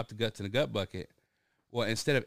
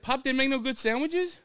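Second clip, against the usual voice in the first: puzzled; 6 dB louder than usual. Retold or imitated. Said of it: "I said".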